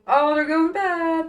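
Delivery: loud, slow, high nervous voice; a jittery coworker blurting out in panic; panicked Al. Acted. Al's sudden voice startles Rinku.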